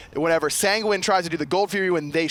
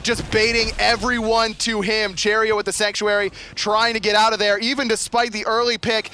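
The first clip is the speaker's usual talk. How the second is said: raised, driving fight commentary; excited play-by-play; 5 semitones higher, 4 dB louder than usual. Real, not acted. Caster calling the fight as Energy wins, excited. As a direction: keep the hype up through the fight.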